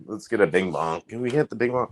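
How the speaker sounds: usual speaking voice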